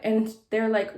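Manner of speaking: ordinary talk, nothing marked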